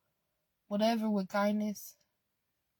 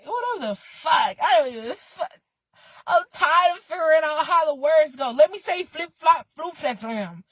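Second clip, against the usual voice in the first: louder and higher, half laughing; exasperated laughing rant; 8 semitones higher, 10 dB louder than usual. Real, not acted. Venting to viewers about tripping over words, loud and laughing. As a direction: blow up at your own tangled tongue, laughing. Frustrated, laughing at own stumbling.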